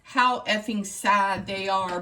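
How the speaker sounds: ordinary talk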